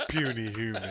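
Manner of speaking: mock dragon, low and laughing. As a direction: sneer like a haughty dragon. Mock villain banter.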